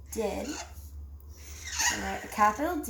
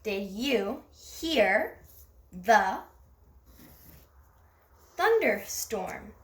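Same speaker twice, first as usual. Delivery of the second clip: slow reading aloud; much slower than usual. Shown as a demonstration. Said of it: "So we underlined you".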